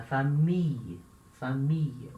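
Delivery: "família" as fa-MEE-y with a long ee; stretched I. Shown as a demonstration. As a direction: draw out the I in "família", no L sound. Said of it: "você bem estica essa letra I".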